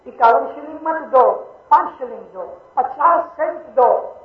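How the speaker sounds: loud, high and slow, each amount hammered; emphatic preaching. Real, not acted. Preacher urging his congregation, loud, high and slow. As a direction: drive every amount home to the hall.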